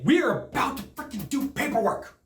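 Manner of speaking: high, emphatic retold protest; exasperated. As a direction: stress each word in exasperated disbelief. Retold exasperation at interrupted closing.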